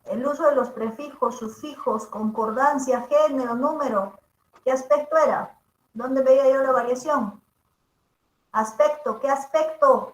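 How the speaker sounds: ordinary delivery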